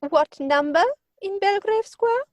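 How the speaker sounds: haughty high query. Acted. Lady Bracknell grilling Jack, haughty high question. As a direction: demand the number from high above.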